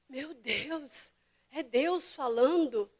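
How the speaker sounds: ordinary talk, nothing marked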